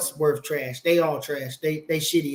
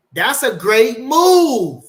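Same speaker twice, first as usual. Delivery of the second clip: stretched out slow and much louder, higher; excited praise; 6 semitones higher, 9 dB louder, much slower than usual. Real, not acted. Praising Nate's fight plan, drawn out and loud. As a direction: savour every word of it.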